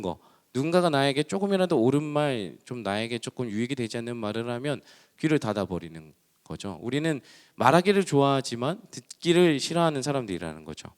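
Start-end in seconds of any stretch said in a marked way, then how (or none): none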